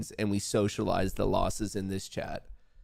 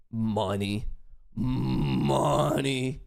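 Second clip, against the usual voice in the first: triumphant; much slower than usual. Real, not acted. Celebrating profit.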